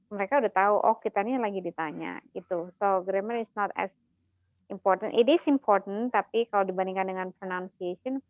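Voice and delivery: normal talking voice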